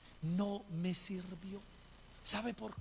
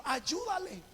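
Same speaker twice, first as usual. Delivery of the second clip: high-pitched imitated dream voice; voz del sueño; 10 semitones higher, slower than usual. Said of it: "escuchó una voz que le dijo". (voz aguda que llama en sueños)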